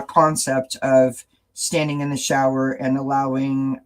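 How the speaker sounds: normal talking voice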